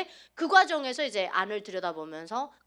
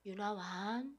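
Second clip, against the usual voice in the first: soft, tender call of her name; gentle voice of God; 3 semitones lower, 9 dB quieter, much slower than usual. Retold or imitated. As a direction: call her softly like a loving father. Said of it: "정말 부드러우신 목소리로".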